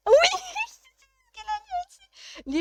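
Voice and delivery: high squeal breaking into laughter; loud yes dissolving into giggles; delighted laughing. Real, not acted. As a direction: laugh through the words, high-pitched. Cracking up at chat's matching answer.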